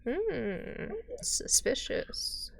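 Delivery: slow whispered suspicious hiss; whispered suspicion. Pretend. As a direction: breathe it out slowly, suspicious.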